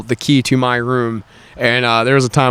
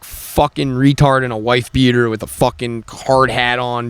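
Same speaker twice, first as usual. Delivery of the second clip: normal talking voice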